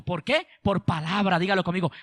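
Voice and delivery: ordinary talk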